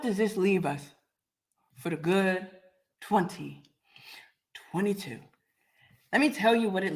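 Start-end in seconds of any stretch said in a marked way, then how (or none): none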